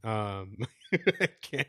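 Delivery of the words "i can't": helpless words through laughter, raised pitch; real laughing. Real, not acted. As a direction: crack up while speaking. Cracking up, unable to continue.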